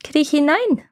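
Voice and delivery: high, swooping witch command; scheming witch. A narrator's reading. Witch sending Gretel into the oven, coaxing command. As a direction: coax her inside, pitched high.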